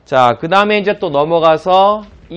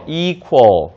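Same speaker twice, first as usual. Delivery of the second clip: "equal" stretched out slowly; slow model equal. Shown as a demonstration. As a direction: draw "equal" out for students. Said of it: "자, 발음해보세요".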